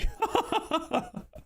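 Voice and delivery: high, falling burst of laughter. Real, not acted. Streamer amused at own advice to Carrick, high laugh.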